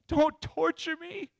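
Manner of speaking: high, drawn-out begging cry; acted tormented demoniac; crazed plea. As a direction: plead slowly at a high pitch. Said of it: "He cried out. He exclaimed".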